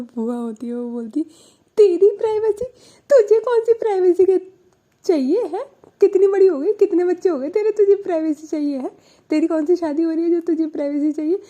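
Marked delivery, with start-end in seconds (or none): 1.8-11.4 loud, high, scolding aunt voice; loud high mocking scold. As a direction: scoff at her wanting privacy, loud and high. Aunt scolding niece about privacy.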